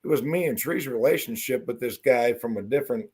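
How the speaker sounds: normal talking voice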